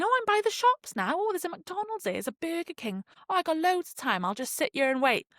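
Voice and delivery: lured-in traveller. Retold or imitated. Mocking a relaxed oblivious traveller.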